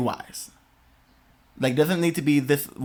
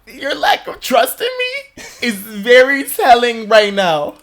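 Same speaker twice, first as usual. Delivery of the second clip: mock sass; 9 semitones higher, 6 dB louder, much slower than usual. Retorting to mom, quoted self.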